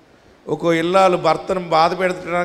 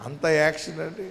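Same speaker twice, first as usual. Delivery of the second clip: softer, teasing echo with a rise on "action"; a wry, quieter "action" that lifts at the end; mocking repeat; 5 dB quieter than usual. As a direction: echo the husband's "అంత action అండి" with mock disbelief. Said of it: "ఏంటి?".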